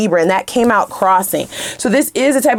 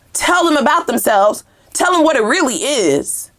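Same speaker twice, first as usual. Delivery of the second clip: normal talking voice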